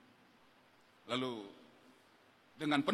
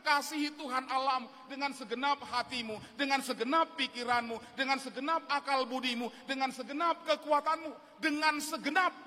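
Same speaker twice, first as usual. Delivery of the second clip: building proclamation; 9 semitones higher, 3 dB louder than usual. Acted. Preacher quotes scripture, building.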